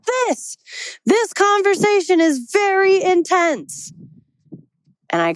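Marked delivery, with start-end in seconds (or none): 0.1-3.9 imitating an exasperated friend, voice jumping way up; high-pitched, worked-up retort, each word pressed. Friend answering her request for an example, exasperated. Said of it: "mad, upset, frustrated".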